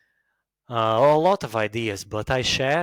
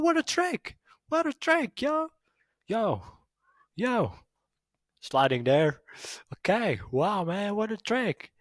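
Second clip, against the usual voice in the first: very high, hammed-up California dude; California accent; 10 semitones higher than usual. Shown as a demonstration. A Californian amazed by a roller skater's trick, squeaky mock California accent. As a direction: gush like a cartoon Californian, way up high. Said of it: "in California, they speak like".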